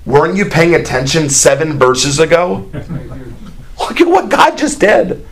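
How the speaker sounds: lightly voiced Moses, fed up, last line jumping high; a hint of an exasperated leader pleading with his people; mild mock exasperation, rising to a high squeak at the end. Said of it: "And Moses is like".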